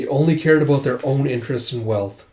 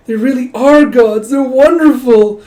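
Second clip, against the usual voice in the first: higher, louder and slow, gushing; swooning fan; 10 semitones higher, 9 dB louder, slower than usual. Acted. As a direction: drag it out like a starstruck admirer. Spectator swooning over the dragons.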